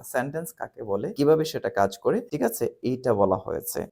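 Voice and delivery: usual speaking voice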